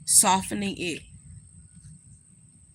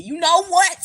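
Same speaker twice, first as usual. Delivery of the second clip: much higher, louder hype shout; hammed-up arena hype; hype call; 11 semitones higher, 8 dB louder than usual. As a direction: rile up the crowd. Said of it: "came through and said".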